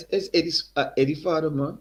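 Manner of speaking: put-on high, hearty Guyanese accent. Eddie's father greets the young singer in a high Guyanese accent. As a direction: greet him like an old Guyanese man. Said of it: "Strong accent again".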